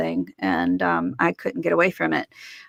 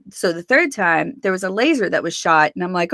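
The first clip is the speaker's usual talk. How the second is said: ordinary talk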